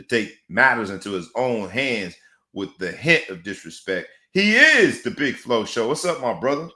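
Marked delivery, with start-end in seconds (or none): 4.3-6.7 loud, raised hype introduction; from the chest. Shown as a demonstration. Host introducing his co-host on a podcast, loud from the chest. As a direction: announce the name big, with reverence. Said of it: "say it with your chest".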